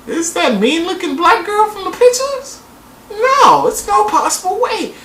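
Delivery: high-pitched incredulous questioning; mock disbelief. Acting out own disbelieving reaction.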